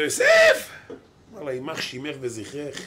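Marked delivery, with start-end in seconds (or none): 0.0-0.7 high-pitched woman's shout; mock yelling. Woman calling out to Yosef, high mock yell. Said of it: "והיא צועקת לו". 1.5-2.8 slow, very soft muttered curse; hushed curse. Acted. Yosef answering the woman, slow hushed curse.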